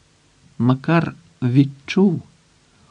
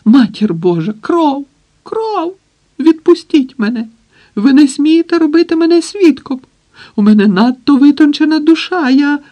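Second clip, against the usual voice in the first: high, loud panicked wailing; hysterical scream; 13 semitones higher, 5 dB louder than usual. A narrator's reading. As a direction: shriek in horror, pitched way up. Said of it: "Літератор закричав".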